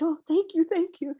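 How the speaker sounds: high, gushing thank-yous; grateful thanks. Retold or imitated. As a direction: overflow with relief and gratitude. Thanking teacher after his kindness.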